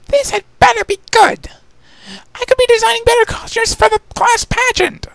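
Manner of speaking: very high, huffy lady voice; annoyed lady. Acted. Rarity complaining while dragged, high and huffy. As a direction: complain grandly.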